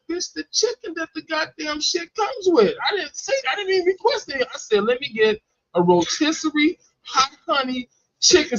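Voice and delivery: high-pitched indignant retort; exasperated. Retold or imitated. Customer repeating his order to Subway worker, exasperated. Retold quarrel over chicken type.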